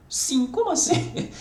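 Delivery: laughing, jumping high on como assim; mock baffled laugh at a silly answer; laughing disbelief. Teacher laughs at a student's exam answer, amused disbelief. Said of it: "Resposta, sim".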